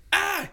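short high, loud shriek; mock scared kid's scream; imitated scream. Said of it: "He'd scream".

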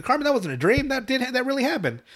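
put-on high insistent kid voice; Kyle voice. Kyle telling Cartman it was real, high.

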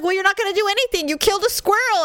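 high, quick, imitated husband's retort; exasperated husband. Husband answering panicked wife, exasperated. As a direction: rattle it off as the dismissive husband.

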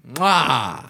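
a smacked kiss off the fingertips; chef's kiss. Real, not acted. Praising the shrimp and grits with a chef's kiss. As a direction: kiss the air like a proud chef. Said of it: "He just did a chef's kiss".

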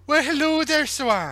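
high, lilting Welsh villager greeting; sing-song Welsh accent, pitched high. Acted. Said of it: "Such a good Welsh accent".

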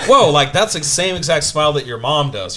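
high, loud amazed whoa; mock amazement. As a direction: act stunned at the copied smile. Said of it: "and you're like".

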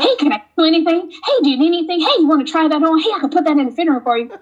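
high, rapid-fire eager offers; overly helpful salespeople. Retold or imitated. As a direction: pester the customer in a high, hurried voice. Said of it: "people aren't too helpful".

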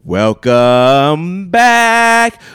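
loud, high, hugely drawn-out announcer call; ring announcer. Pretend. Host opening the show, booming drawn-out announcer. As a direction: stretch every word like a ring announcer.

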